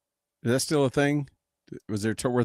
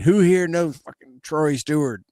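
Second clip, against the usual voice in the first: yelling out; 4 semitones higher, 4 dB louder, slower than usual. Retold or imitated. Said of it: "yelled out".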